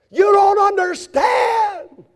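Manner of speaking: high, loud, slow furious shout; angry shout. Pretend. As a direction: yell it like a betrayed man. What Jesus did not shout.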